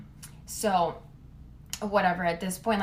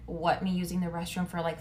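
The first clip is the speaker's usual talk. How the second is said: usual speaking voice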